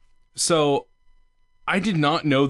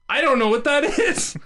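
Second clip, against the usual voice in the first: put-on high squeaky kid voice, rising at the end; childlike confusion; 13 semitones higher than usual. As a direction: play a small boy who has no idea what it is. Child self quoted.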